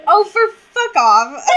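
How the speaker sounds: loud, laughing protest; laughing exasperation. Real, not acted. Cohost teasing her, laughing exasperated protest.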